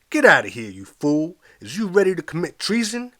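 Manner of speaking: loud brassy scream; trombone scream. Acted. A voice screaming at the narrator to leave, loud. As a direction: blast it like a trombone. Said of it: "a voice of trombone timbre screamed".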